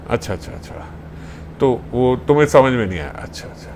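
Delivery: sarcastic whisper. Acted. Sakhi sarcastically disbelieving.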